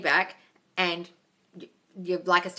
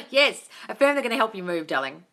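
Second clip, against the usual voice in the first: normal talking voice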